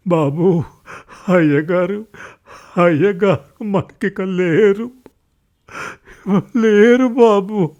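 high, loud, broken lament; servant's drawn-out tearful cry. A narrator's reading. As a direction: wail the news of a death. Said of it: "ఏడుస్తున్నాడు నరసయ్య".

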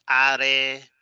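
"are" in two syllables, ah-reh; read as in Spanish. Shown as a demonstration. Teacher showing learners' reading, Spanish-style pronunciation. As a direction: say "are" with tapped r and final e. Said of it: "como se lee en español".

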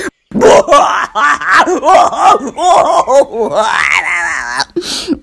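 loud high guffaw; fox laughing. Acted. Burr Fox laughing at the stuck rabbit, loud guffaw. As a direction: laugh till you're nearly sick. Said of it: "laughed until he was almost sick".

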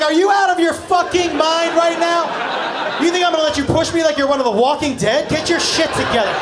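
hammed-up panicked outrage; mock outraged yell. Comedian yelling at the jump worker about to push him. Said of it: "And I was like".

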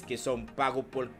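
usual speaking voice

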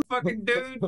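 lightly voiced mock exclamation. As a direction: voice the crash as a comic outburst. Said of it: "was like".

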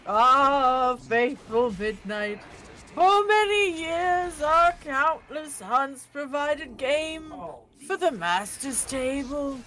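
put-on slow, grandly declaimed old man; stuffy old man. Player play-acting for unimpressed friends, stuffy old man voice. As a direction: draw it out like an aged huntsman orating. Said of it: "my stuffy old man voice".

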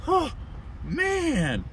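high, loud awestruck exclamation; bewildered and excited. Retold or imitated. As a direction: marvel at it, dumbfounded. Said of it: "so, like, bewildered and excited".

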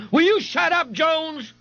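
very high-pitched irritated call. A narrator's reading. A sergeant calls out to a noisy prisoner, irritated. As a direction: silence him from across the room. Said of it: "the sergeant called out".

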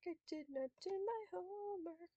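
small high hushed voice; mock lament. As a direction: whimper it very quietly. Mock lament during game.

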